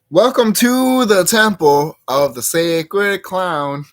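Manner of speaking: slow, booming temple-greeter voice; sacred clown voice. Pretend. Clown host welcoming viewers to the temple, booming slow. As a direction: announce it like a mystic clown host.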